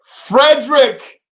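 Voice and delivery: high, slow, stretched-out shout of the name; drawn-out greeting call. Real, not acted. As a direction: call the viewer's name like a hype man. Calling out chat name.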